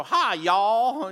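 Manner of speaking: mock southern greeting. Shown as a demonstration. Pastor jokes about mispronouncing Hebrew, mock southern greeting. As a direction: drawl "Hi, y'all" like a southern hello. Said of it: "I keep wanting to go".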